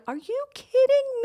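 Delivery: incredulous high-pitched gasp of a question; retold disbelief. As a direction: stretch it out high, utterly amazed. Acting her amazed reaction.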